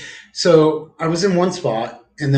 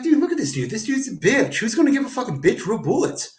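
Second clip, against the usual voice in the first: high-pitched mocking jab; scornful high-pitched quote; retold taunt; 8 semitones higher than usual.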